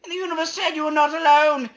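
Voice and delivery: high, loud, squeaky proclamation; squeaky universe voice. Acted. Voicing the universe to the player as a loud chipmunk.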